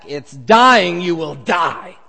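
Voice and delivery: loud, heavy stress on die; emphatic. Acted. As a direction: hammer the certainty of death. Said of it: "it's emphatic".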